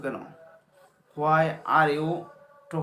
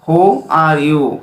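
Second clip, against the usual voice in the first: each word drawn out slowly for learners; slow model sentence; 16 dB louder, much slower than usual. Shown as a demonstration. Teacher modelling a question for students, very slow. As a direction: say it very slowly so students can follow. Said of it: "teacher models sentence".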